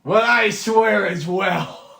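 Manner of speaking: high, loud and slow sarcastic echo; dwarf mocking Yarner's oath, high, loud and slow. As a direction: throw his oath back at him.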